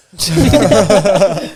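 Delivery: a high, loud gleeful burst of laughter. Retold or imitated. Squaring up to Tyson, he laughs, high and loud with glee. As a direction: laugh like the fight you wanted just arrived.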